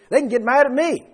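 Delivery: high, loud challenge landing on "me"; assertive. Acted. Preacher voicing God taking over vengeance, assertive. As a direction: take the blame on yourself, forcefully.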